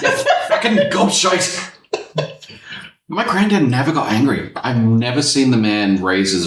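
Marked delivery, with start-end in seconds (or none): 0.5-1.7 put-on Scottish accent, slow, laughing, pitched up; mock Scottish insult through a laugh; Scottish swearing. Friend mocks Scottish grandad swearing, Scottish accent. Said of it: "even when they're abusing you".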